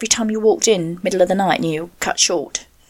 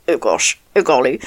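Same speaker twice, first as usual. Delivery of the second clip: lower, mock upper-class English exclamation; mock posh English; 7 semitones lower than usual. Host to listeners, mocking a posh English accent. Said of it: "and you say I'm posh".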